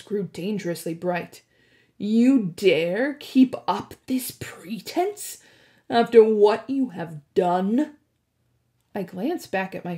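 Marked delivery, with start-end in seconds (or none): 2.0-5.4 slow, threatening whisper; menacing whisper. Acted. Hades angered. 5.9-8.0 louder, rising furious demand; angry accusation. Acted. Hades angered.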